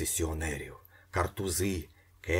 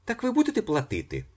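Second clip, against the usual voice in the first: much higher fast lady's voice; young lady; faster than usual. A narrator's reading. Young lady asking if they'll pay, high and fast. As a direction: press them politely as a clerk.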